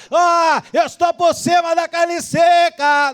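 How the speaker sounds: high, drawn-out bragging cry; hammed-up gloating; mock arrogant boast. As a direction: stretch the boast out loudly.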